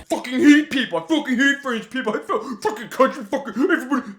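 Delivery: high-pitched imitated angry sputtering; mad ranting. Upset Billy ranting to friend outside the hotel, mock mad. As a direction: rant and stumble over the curses, hammed up. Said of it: "He was so mad".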